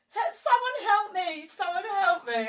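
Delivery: high, mock cry for help; mock panic. Wife stuck on bike, high mock plea for help. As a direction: call for help half laughing. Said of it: "I was like".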